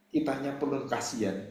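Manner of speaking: normal talking voice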